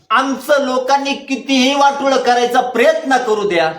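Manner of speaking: high, insistent sermon voice; fervent preaching. Real, not acted. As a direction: drive the promise home to the congregation. Preaches with raised voice.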